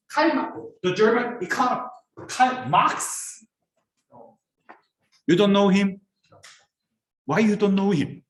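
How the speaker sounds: usual speaking voice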